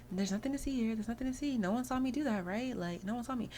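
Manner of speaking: high, nothing-to-see-here cover-up; feigned innocence. Acted. Culprit washes hands after mischief, feigning innocence.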